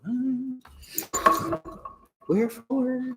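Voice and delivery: HMM and WHEREFORE very high and soft; high questioning note. Shown as a demonstration. Teacher imitating a movie to show inflection, high questioning WHEREFORE. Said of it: "Like, you gotta go".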